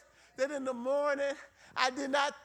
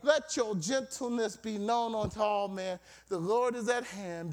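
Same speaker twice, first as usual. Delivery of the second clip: ordinary talk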